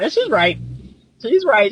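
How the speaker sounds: ordinary talk, nothing marked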